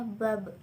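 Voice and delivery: "bab" with the last b bouncing; bouncing final b. Shown as a demonstration. Teacher showing a resting Ba, final b bouncing. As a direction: let the closing b of "bab" rebound audibly. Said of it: "suaranya terdengar mantul".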